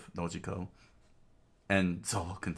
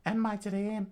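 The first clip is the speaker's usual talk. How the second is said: put-on high-pitched girl's inner monologue; high voice musing to herself; Nami thinking to herself; 14 semitones higher, 4 dB louder than usual. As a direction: think aloud in a high, unhurried voice. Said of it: "she thought to herself".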